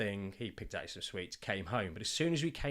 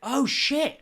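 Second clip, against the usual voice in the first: sudden realisation; 13 semitones higher, 10 dB louder than usual. Retold or imitated. Dad suddenly realises what son means.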